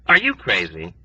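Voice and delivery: usual speaking voice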